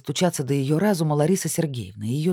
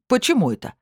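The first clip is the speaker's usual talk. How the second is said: indignant; 9 semitones higher, 3 dB louder than usual. Acted. Belokon butts in indignantly.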